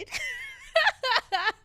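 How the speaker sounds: sudden high-pitched laugh; high burst of laughter. Real, not acted. At her own joke, a high laugh. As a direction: crack up out loud.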